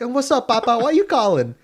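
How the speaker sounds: mock radio host, loud and high. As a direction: play the on-air jock. Imitating the radio DJ.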